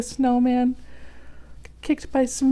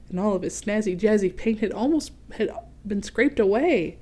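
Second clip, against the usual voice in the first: ordinary delivery, nothing marked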